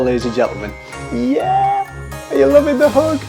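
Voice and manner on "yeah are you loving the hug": gushing excited. Acted. Virtual hug, gushing.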